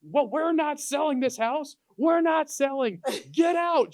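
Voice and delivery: very high, slow outraged shout; mock screaming. Wife screaming at the realtor in her kitchen, mock screaming. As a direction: imitate an irate wife screaming someone out. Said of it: "She started screaming at me".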